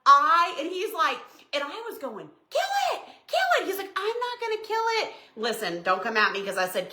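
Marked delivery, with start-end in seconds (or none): 2.5-3.7 very high, squealed order; re-enacted panic. Retells panicked order to husband. 4.0-5.1 high-pitched mock refusal; refusing husband.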